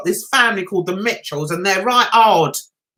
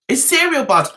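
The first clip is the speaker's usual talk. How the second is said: ordinary delivery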